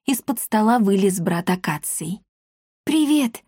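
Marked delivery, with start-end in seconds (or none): 2.9-3.4 high, happy greeting; delighted. A narrator's reading. Girl greeting the cat from under the table, delighted. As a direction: greet a friend with pleased surprise. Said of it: "обрадовалась ему Дарина".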